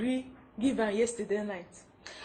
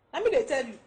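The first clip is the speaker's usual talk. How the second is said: ordinary delivery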